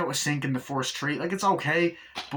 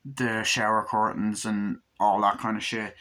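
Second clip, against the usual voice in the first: usual speaking voice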